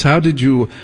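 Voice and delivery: normal talking voice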